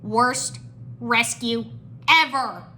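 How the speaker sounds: loud, word-by-word grumble; complain, leaning on each word. A narrator's reading. Jay gripes as a chained captive, complaining. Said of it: "Jay complained".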